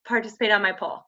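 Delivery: ordinary delivery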